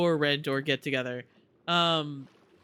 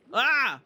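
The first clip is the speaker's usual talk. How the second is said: startled yelp; 7 semitones higher, 5 dB louder than usual. Real, not acted. Attacked in water.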